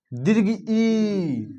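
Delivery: ई held very long and slow; long vowel ee. Shown as a demonstration. Teacher demonstrating a long ई to the class, slowly stretched. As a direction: draw out ई like a long sound. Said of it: "नहीं बोलता है".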